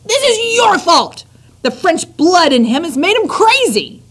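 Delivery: high, loud accusing cry; blaming shout. Acted. Lester blames Antoinette, high loud accusing voice. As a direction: blame her at full pitch.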